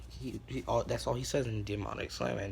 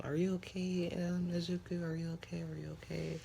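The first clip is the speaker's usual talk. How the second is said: mock-concerned girls, pitched up; high girly voice; 7 semitones higher than usual. Girls worried about Izuku, high fussing voice.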